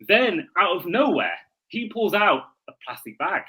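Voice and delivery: storyteller's once-upon-a-time read, swinging pitch; a mock-solemn fairy-tale narration; dramatic reading. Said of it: "do a dramatic reading".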